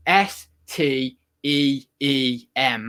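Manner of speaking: S T E E M letter by letter, slow and separated; slow, deliberate spelling of S T E E M; spelled out slowly. Shown as a demonstration. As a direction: spell "S T E E M" with a gap after each letter. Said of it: "That's what Steam is".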